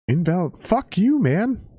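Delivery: high furious yell at the app; outraged yell. Real, not acted. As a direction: scream it at the screen. Screaming at app.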